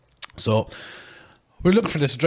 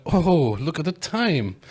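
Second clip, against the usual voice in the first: higher-pitched, put-on excuse to leave; feigned surprise; 5 semitones higher, slower than usual. Guest making excuses to escape, feigned surprise. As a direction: act as if just noticing.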